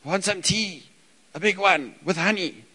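put-on high, loud little-kid whine; little boy pleading for his tea; toddler asking for tea. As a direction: play the insistent three-year-old. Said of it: "and it's".

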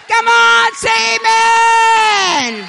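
loud, high rally cry to the crowd; preacher's call. Real, not acted. Preacher calls the crowd to shout amen. Preacher rallies congregation to respond.

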